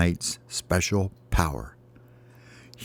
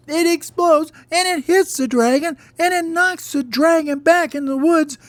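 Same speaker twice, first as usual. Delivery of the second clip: very high, louder storytelling at the climax; thrilled storyteller squeal; excited narration; 5 dB louder than usual. A narrator's reading. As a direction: tell it at a high-pitched, breathless-with-glee peak. Climax of the fire fight.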